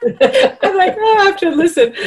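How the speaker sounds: high, giggling through words; laughing. Real, not acted. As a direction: laugh while you talk. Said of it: "with an Italian accent".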